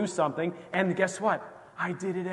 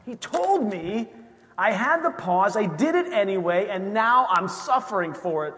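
higher and louder, mock kicking yourself; self-scolding; 5 semitones higher, 4 dB louder than usual. [name] berating himself for ignoring the warning, higher and louder.

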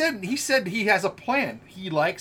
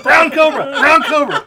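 loud shout through laughter; laughing shout; 7 semitones higher, 13 dB louder than usual. Pretend. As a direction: yell it while cracking up.

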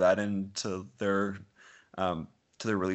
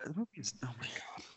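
hushed whisper under the breath; whispered aside; 6 semitones higher, 12 dB quieter than usual. Real, not acted. Aside about the long movie.

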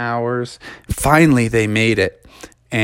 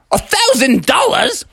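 very high, outraged disbelief; shocked protest. Acted. Dad refusing the thousand-dollar tickets, very high shocked protest. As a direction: balk at the price.

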